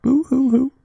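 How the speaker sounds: high, loud boo-hoo sob; fake crying. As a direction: ham up a sobbing boo hoo. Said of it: "it's such convincing crying".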